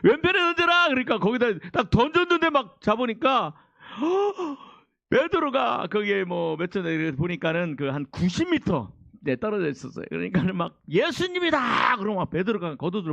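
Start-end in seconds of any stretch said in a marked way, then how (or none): 0.0-0.9 calling out. Acted. Repeated call. 10.9-12.0 excited cry. Acted. Disciple exclaims in recognition.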